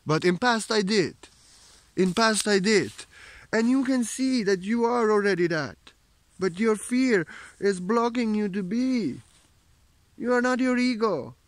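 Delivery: high, loud, ringing preacher's call; fervent preaching. Acted. Spiritual teacher rousing viewers to leave ego behind, in fervent preaching. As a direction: proclaim it to the whole hall.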